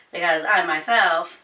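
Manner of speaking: ordinary delivery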